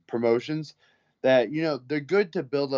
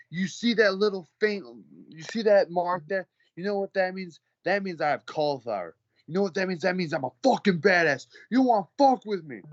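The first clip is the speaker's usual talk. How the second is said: higher-pitched mock tough-guy challenge; imitated boaster; 7 semitones higher than usual. Wannabe fighter squaring up to bouncer, mock-belligerent. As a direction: puff it up into a hammed-up threat.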